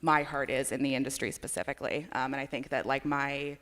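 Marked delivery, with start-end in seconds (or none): none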